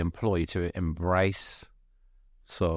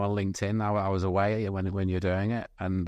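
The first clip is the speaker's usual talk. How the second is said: ordinary delivery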